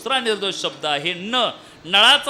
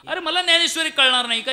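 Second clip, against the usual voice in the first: raised, quick indignant voice; offended retort; 7 semitones higher, 5 dB louder, faster than usual. Acted. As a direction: act out a proud man taking offence. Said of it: "ते रागाने गेले". (रागाने, दुखावलेल्या अहंकाराने)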